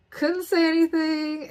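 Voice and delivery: choked up, real tears; teary. Real, not acted. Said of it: "I was still kinda on crying".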